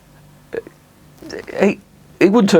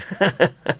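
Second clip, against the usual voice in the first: high laugh; 8 semitones higher than usual. Acted. Mock entertainer bit.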